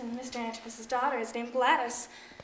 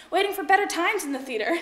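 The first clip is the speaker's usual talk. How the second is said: ordinary delivery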